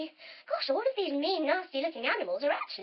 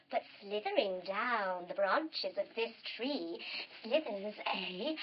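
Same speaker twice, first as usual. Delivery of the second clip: low, slow, hushed build-up; soft, drawn-out storyteller menace; creeping suspense; 5 semitones lower, 6 dB quieter, slower than usual. Acted. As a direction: stretch it out as if slithering. Suspense before the snake appears.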